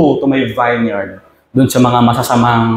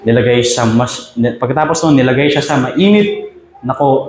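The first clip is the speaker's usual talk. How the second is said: ordinary talk, nothing marked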